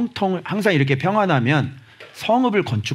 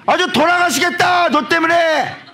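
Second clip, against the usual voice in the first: much higher, hammed-up moaning voice; hammed-up complaint; 4 dB louder than usual. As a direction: whine it like you're dying of it. Acting exaggerated complaint.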